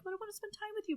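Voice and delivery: high, little pleading whine; mock pleading. Wife pleading with husband to stay home, high whine. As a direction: coax him like a clingy partner. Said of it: "And I might be like".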